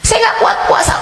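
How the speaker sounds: high, whining plea; whiny complaint. Acted. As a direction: whine like a tired believer. Voicing a congregant's complaint. (keluhan merengek dengan suara tinggi)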